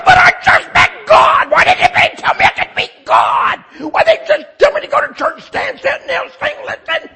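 high, loud, desperate yell at the cop; frantic shouting. Retold or imitated. New convert shouting at the cop. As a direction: shout it like you can't stop. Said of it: "I said".

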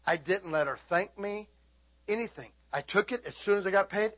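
normal talking voice

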